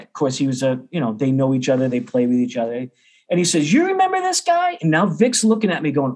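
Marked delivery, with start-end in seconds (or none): 3.7-4.8 very high-pitched, eager question; mock-excited, voice leaping up high; gleeful prompting. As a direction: imitate a friend gleefully presenting someone. Friend presents him to old teacher.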